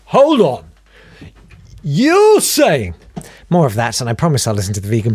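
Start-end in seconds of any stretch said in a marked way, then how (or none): 0.1-2.9 higher, louder interrupting TV presenter voice; Piers Morgan impression. Host mocks a pushy interviewer, higher and louder. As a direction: cut in like a pushy TV host, hold on hold on. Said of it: "I do enjoy your Piers Morgan impression".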